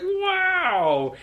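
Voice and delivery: put-on a high, amazed kid's "Wow"; kids' wow. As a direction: gasp it out like an impressed child. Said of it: "the kids are like".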